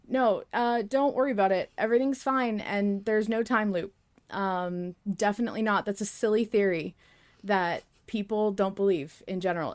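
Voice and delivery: ordinary delivery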